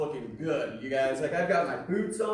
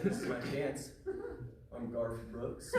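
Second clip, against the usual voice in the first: put-on hushed excited kid; 7 semitones lower, 11 dB quieter than usual. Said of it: "And I get all excited".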